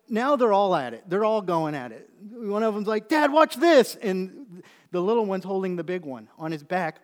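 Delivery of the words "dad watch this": put-on high, loud kid showing off to Dad; excited kid calling out. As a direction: call out eagerly for Dad's attention. Child showing off to father.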